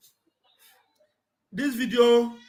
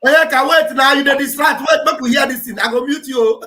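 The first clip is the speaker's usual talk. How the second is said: loud, higher-pitched scolding; raised-voice interruption; 4 semitones higher, 8 dB louder than usual. Real, not acted. Host telling the distracting guest to wait, loud and insistent. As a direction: cut in loudly over the chatter.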